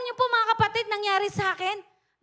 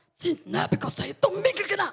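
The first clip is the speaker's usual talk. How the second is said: a hint of a demonic voice, rising at the end; lightly voiced demon warning her; possessed woman's voice. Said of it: "Sabi po niya".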